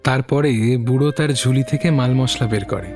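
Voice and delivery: usual speaking voice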